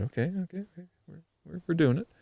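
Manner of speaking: high, soft, tentative urging; keyed-up but keeping it quiet; hushed self-talk. Retold or imitated. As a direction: talk yourself through it in a small high voice. Acting out self-talk on hooking fish.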